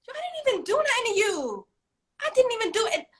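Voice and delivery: high-pitched, incredulous parent defence; exasperated protest. Acted. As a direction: protest innocence to a screaming kid. Parent protests at screaming child.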